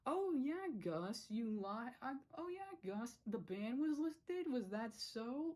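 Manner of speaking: high, slow sneering sarcasm; mock sarcastic villain. [name] taunting Gus in front of the club, high slow sarcasm. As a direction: drag it out to mock him. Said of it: "he could have spun that like".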